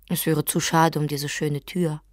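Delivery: plain reading voice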